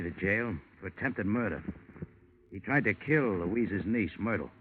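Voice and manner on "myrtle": ordinary talk, nothing marked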